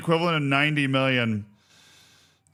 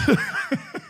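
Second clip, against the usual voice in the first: high burst of laughter. Real, not acted. Laughing at own joke.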